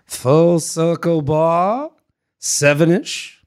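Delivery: put-on very slow, drawn-out British accent; drawling mock-British suggestion. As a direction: stretch each word in put-on British. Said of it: "I have to go accent".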